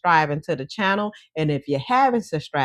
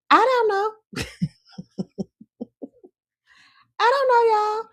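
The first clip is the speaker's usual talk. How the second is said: high squeaky mock-innocent voice; playful squeal. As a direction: play dumb in a squeaky voice. Joking about her own accent.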